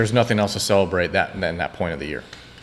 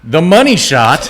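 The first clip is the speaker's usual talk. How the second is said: loud, drawn-out title announcement; segment announcer; 4 semitones higher, 7 dB louder, slower than usual. Pretend. Host launching the weekly segment, in a big announcer delivery. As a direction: announce it big, pitched up and slow.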